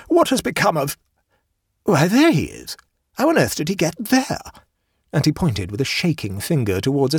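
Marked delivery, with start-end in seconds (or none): 0.1-4.4 high, louder burst of astonishment; startled. A narrator's reading. Belturbet seeing the vanished minister again, startled. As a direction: break off, then exclaim in amazement.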